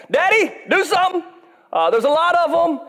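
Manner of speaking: put-on high, frightened little-kid plea; begging daddy to fix it, childlike; crying for daddy. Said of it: "I would cry for, like ... my daddy".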